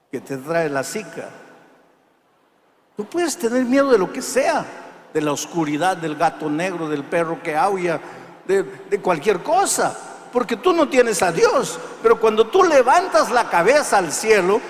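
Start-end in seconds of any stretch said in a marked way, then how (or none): 3.0-14.6 preaching at full force, loud and high; predicación enérgica. Acted. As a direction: thunder it at the congregation. (predicador a plena voz)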